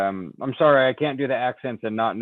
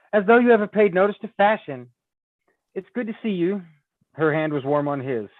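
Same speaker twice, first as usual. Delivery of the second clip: higher teasing lady's voice; Anne's lady voice; 6 semitones higher than usual. Acted. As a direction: tease him like an old friend. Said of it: "So I think this is her".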